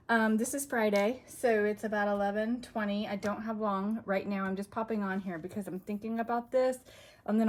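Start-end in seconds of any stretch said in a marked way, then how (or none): none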